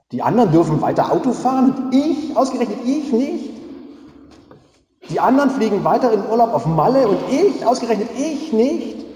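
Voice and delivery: high, loud whiny protest; mock indignation. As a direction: play the outraged victim. Mock indignant self-pity. (gespielt empörtes Jammern, hoch und laut)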